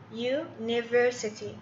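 "university." drawn out very slowly, with a clear "yoo" at the start; slow classroom demo of "university."; slow model word. Shown as a demonstration. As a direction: stretch out "university." syllable by syllable for learners. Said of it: "اليو تنطق على إنها يو".

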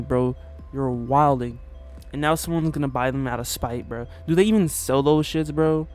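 ordinary talk

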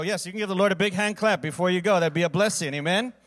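loud, high, upbeat call-out; rousing call. Real, not acted. Pastor urging the congregation to clap and greet each other, loud. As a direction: lift the room to clap.

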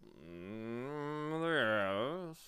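drawn-out disdainful hum and assent; disdainful bee. A narrator's reading. Bee acknowledging the humbug, drawling disdain. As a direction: concede it with scorn, slowly. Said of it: "with complete disdain".

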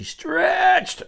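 word drawn out long and high; stretched-out word. A narrator's reading. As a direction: stretch the word like reaching fingers. Acts out the stretching.

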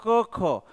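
plain क, then ख with a strong puff of air; weak-strong consonant pair. Shown as a demonstration. Teacher pairing क with its strong sound ख, demonstrating aspiration. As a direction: contrast unaspirated क with aspirated ख. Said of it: "क का strong sound होता है ख".

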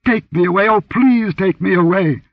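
high, loud, desperate begging; hysterical pleading. Acted. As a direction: beg to be taken away in panic. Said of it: "she gasped hysterically".

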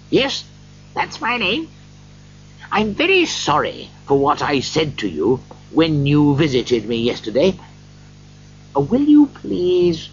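lightly voiced, earnest old Scrooge; apologetic Scrooge. A narrator's reading. Scrooge says sorry to the gentleman, earnest.

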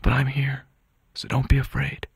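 calm him while warning him, in short phrases; hushed reassurance. Acted. Son reassures wounded father at bedside.

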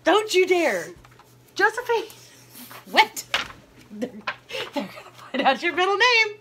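high laughing shriek; shrieking protest. Real, not acted. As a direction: squeal and scold while laughing. Being sprayed, shrieking at sister.

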